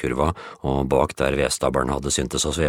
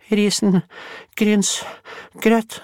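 very high, very slow moan for porridge; drawn-out moan; much slower than usual. Acted. Bulle fainting on the floor, moaning for porridge. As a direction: collapse and stretch the word out.

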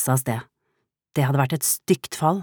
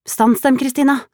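high, loud command; urgent shout; 12 semitones higher, 5 dB louder than usual. A narrator's reading. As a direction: yell the order out quickly. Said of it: "ropte Solveig fort".

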